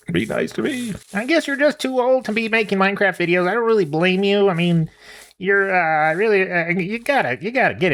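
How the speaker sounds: the chicken lectures him to get it together; lightly voiced scolding chicken.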